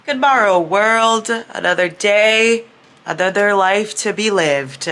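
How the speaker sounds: loud, high, slow theatrical proclamation; mock-grand greeting. Vlogger hailing the world at breakfast, mock-grand. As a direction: hail the world grandly, drawing out each word.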